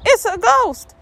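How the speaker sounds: high, hammed-up cry of alarm; mock-terrified high yelp; frightened cry. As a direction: cry it out like scared disciples. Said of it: "they cried out".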